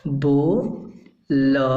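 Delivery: बोलता drawn out very slowly, each syllable clear; drawn-out demo. Shown as a demonstration. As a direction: stretch बोलता out slowly for the learners. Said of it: "अब जब सीटी लग गई".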